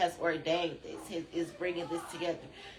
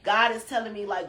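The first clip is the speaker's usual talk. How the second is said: normal talking voice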